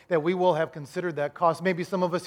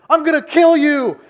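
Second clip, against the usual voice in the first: high, loud panicked threat; roller-coaster rider's full-voiced yell; retold scream; 10 semitones higher, 13 dB louder than usual. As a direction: scream it at the kid next to you. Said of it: "I was screaming the whole way".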